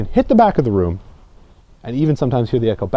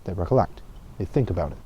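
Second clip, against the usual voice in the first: much lower, much softer, reflective; falling reflective tone; 8 semitones lower, 11 dB quieter, faster than usual. Shown as a demonstration. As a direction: drop down low and soft to reflect. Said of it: "whenever you go down".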